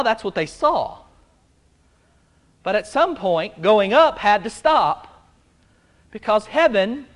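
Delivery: ordinary delivery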